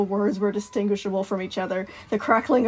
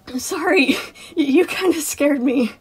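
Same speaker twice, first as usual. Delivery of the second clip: higher, louder apology after a fright; jittery, pitched-up apology; nervous chuckle; 5 semitones higher, 5 dB louder than usual. Acted. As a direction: laugh off a scare, slightly rattled. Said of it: "Sun chuckled".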